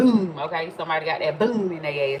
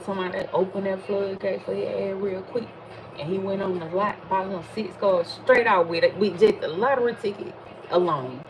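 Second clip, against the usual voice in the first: ordinary talk, nothing marked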